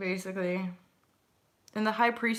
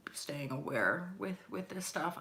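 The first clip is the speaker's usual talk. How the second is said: whisper; 2 semitones lower, 7 dB quieter than usual. Real, not acted. Whispered caution.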